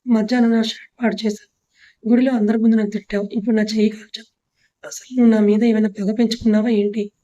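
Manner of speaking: usual speaking voice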